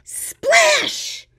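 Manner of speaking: high, loud splash cry; splash sound. Acted. Storyteller making the turtle splash, high loud. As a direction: shout it like water flying up.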